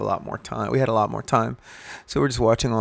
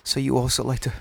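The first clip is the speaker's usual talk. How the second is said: ashamed dad; 2 semitones higher, 3 dB quieter than usual. Retold or imitated. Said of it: "the dad's all ashamed".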